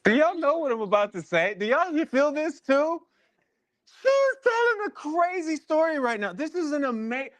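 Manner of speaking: high-pitched, astonished outburst to the room; excited exclamation. Real, not acted. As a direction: voice shoots way up in disbelief. Host exclaims over guest's story.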